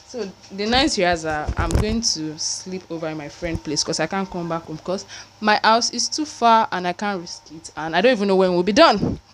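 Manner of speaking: usual speaking voice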